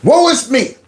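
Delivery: high, slow mock lament, sarcastic; mock whining. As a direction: drag it out like a self-pitying victim. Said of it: "I'm gonna cry that I'm in danger".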